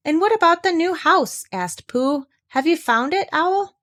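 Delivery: high, eager questioning; Pooh voice. A narrator's reading. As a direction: ask brightly, curious. Pooh voiced asking Owl.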